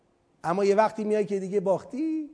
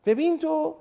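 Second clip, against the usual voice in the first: ordinary talk, nothing marked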